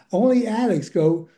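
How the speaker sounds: ordinary talk, nothing marked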